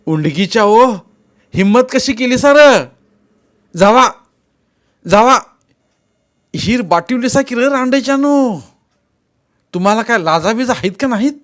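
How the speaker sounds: high, loud abusive shouting; heated scolding at high pitch; angry yelling. A narrator's reading. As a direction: yell insults after fleeing boys. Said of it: "तसा पिराजी ओरडला".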